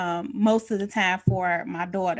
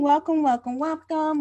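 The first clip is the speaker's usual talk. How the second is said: high, loud, cheery greeting; greeting viewers as they join, upbeat; bright welcome; 7 semitones higher, 6 dB louder than usual. Real, not acted. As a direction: sing out a welcome to new viewers. Greeting viewers joining the stream.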